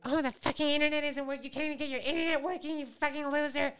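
mock the loser dad, bratty; bratty daughter. Comedian voicing the evil daughter mocking her dad, high and snotty. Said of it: "she's like".

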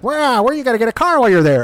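a hint of a pushy little gnome, high and fast; lightly voiced nagging gnome. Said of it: "the gnome jumps in and he's like".

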